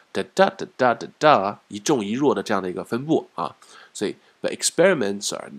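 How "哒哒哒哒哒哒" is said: 哒哒哒哒哒哒 tapped strong-weak; strong-weak rhythm demo; rhythm beats. Shown as a demonstration. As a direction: tap out the stress rhythm. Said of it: "节奏感出来了哈".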